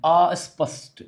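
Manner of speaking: normal talking voice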